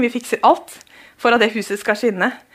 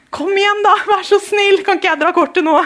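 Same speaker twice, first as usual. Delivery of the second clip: high, pleading begging voice; 7 semitones higher than usual. Retold or imitated. A church member begs a stranger to let them pay, in a high pleading voice. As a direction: beg sweetly to be allowed to pay. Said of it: "de er bare sånn".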